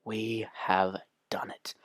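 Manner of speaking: slow, hushed whisper; whispered reveal. Acted. Host revealing what was done at the workshops, dramatic whisper. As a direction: whisper it like a grave secret.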